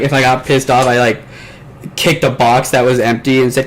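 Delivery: ordinary talk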